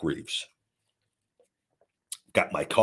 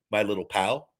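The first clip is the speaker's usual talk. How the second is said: normal talking voice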